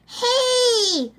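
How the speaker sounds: high, loud call after someone. Acted. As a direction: shout after her as she runs. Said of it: "the frog called after her".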